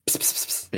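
unvoiced spraying psst noises; water squirt sounds. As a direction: hiss out squirts like a water gun. Villain firing the super soaker.

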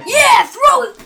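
loud, high cheer of encouragement; excited shout. Real, not acted. Player urging Troll Dad to throw it, excited shout. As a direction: shout it to egg a teammate on.

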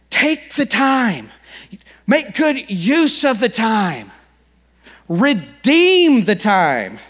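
slow, drawn-out preaching. Acted. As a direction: stretch each phrase like scripture. Quoting Paul, drawn out.

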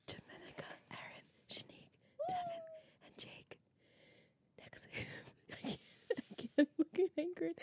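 whispered name list; whispering. Acted. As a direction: whisper while fighting laughter. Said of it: "whisper them all without laughing".

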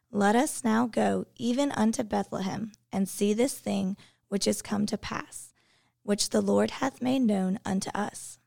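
usual speaking voice

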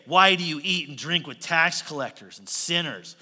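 loud, mocking accusation; jeering. Religious leaders accusing the disciples, jeering. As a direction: jeer it out as a challenge. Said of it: "say it with that jeer".

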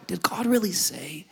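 sly, unvoiced tempter's whisper. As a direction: breathe it like a serpent planting doubt.

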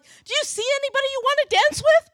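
high, loud, sing-out question from the dance floor; excited dance-floor call. Retold or imitated. Friends dancing by ask the seated speaker, excited call. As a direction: call it out brightly while dancing by.

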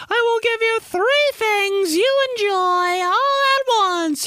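very high, slow campaign promise; mock political candidate. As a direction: proclaim it like a cartoon politician. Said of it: "Neapolitan ice cream running for office".

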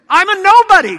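high, loud self-belittling protest; protesting excuse. Acted. Moses tells God he is unfit, loud high protest.

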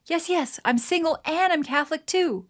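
eager, high yes yes; excited cab driver. Acted. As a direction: blurt it out with excitement. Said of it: "The cab driver is very excited and says".

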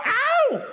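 a sudden high mock "Ow!" from the cow; cow's yelp. Cow poked with a stick.

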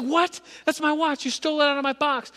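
put-on high, loud indignant child; outraged kid. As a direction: squeal it like a robbed first grader. Kid accusing classmate of theft.

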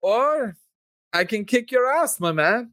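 Roman. Acted. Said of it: "Roman ends with".

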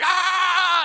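loud, high karate cry; attack yell. Acted. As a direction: let out a sudden fighting yell. Acting out Miyagi's attack cry.

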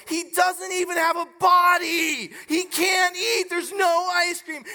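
imitating a kid's high, loud protest; mock tantrum shout on the verge of tears; retold upset child. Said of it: "he starts crying".

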